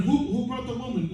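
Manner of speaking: high, comic stammer of alarm; startled disciple. Acted. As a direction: ask it as a hammed-up startled man. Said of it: "One of them went".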